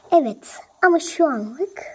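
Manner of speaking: usual speaking voice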